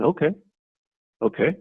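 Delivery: "Okay, okay" with a rising, asking tune; okay as a question. Shown as a demonstration. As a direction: make "okay" sound like a question. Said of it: "And you could say it as a question".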